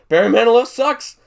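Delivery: a teasing teacher's yell. Retold or imitated. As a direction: shout it down at the kid. Teacher mocking his music.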